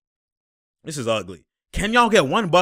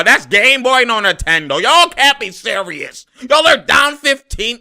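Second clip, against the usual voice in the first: loud, higher, giving-up shout; exasperated yelling; 7 semitones higher, 7 dB louder than usual. Real, not acted. Fan shouts at the TV as his team falls behind. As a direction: yell it like the game is lost.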